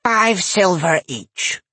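slow, high gruff granny voice; gruff old lady. A narrator's reading. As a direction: grunt out the price. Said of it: "The old lady grunted".

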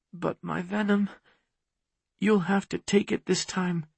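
high, soft woman's voice; worried heroine. A narrator's reading. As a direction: object gently, high and quiet. Worried heroine answers softly.

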